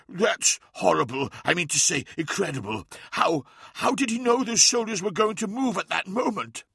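shocked neophyte, rising to high-pitched amazement; astonished character voice, climbing in pitch; shocked pupil. Acted. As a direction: start appalled, then climb high on the question.

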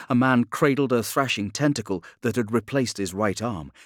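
plain reading voice

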